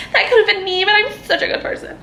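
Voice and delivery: high-pitched mock of the author; mocking imitation. Reader mimicking the author on her dear friend, mocking. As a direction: put on a high, self-righteous mock voice. Mocks the author's self-regarding writing.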